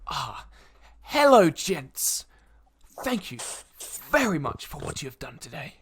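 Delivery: slow, measured noble with lifted openings; courteous noble. Acted. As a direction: greet them as a gracious lord. Game master plays the noble.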